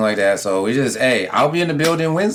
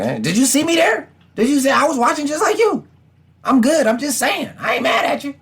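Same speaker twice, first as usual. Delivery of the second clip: mock defensive fan; 11 semitones higher than usual. Fan insisting he was only watching the game, high defensive. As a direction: talk yourself out of being mad, high.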